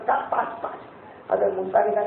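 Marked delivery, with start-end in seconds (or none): 0.3-0.9 whisper. Acted. Prophet's ashamed answer.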